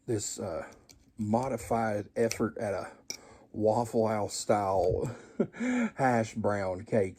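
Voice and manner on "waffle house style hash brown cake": slow grand naming. Acted.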